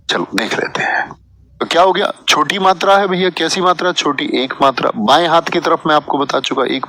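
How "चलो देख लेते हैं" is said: whispered aside. Real, not acted. Teacher whispering to himself while checking answers. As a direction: breathe it as a private aside.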